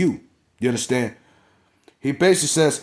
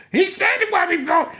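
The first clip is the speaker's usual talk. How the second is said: squealing falsetto sob, much higher; hammed-up bawling cry to the crowd; mock crying wail. Heyman sobbing to the crowd, a hammed-up falsetto wail. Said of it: "Paul Heyman's like".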